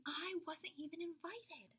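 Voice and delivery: whispered, hurt aside; hushed complaint. A narrator's reading. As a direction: breathe it like a wounded secret. Child's hurt aside in story.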